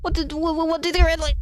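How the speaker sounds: startled, stuttering gasp. Acted. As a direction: blurt it out, tripping over the first words. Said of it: "as you gasp out".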